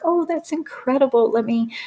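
high, thrilled exclaim; excited. Retold or imitated. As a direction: gush with delight. Lady's excited reaction retold.

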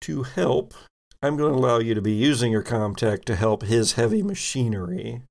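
ordinary talk

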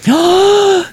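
sharp high mock gasp; a big theatrical gasp. Mock alarm about Gremlins.

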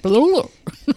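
mumbled nonsense imitating a flub; garbled mock word. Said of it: "Some word that's like".

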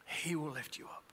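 soft echo. Acted.

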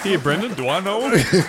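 loud mocking high voice; mock shout. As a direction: shout it back in mockery. Mocking the guest loudly.

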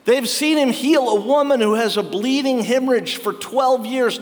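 usual speaking voice

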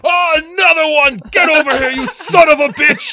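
very high, very loud screech; battle-crazed yell. Acted. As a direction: scream with glee as you charge another monster. Wizard attacks monster in kitchen.

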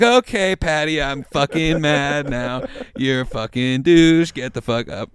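very loud, slow, furious shout; loud mock rage. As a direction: yell him out of bed. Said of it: "I was like".

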